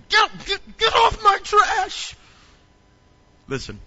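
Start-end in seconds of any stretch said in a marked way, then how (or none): 0.1-2.1 mock yell. Chasing people off his trash.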